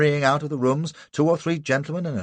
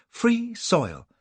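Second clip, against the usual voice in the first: sobbing, higher; 11 semitones higher than usual. A narrator's reading. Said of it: "sobs Cassie".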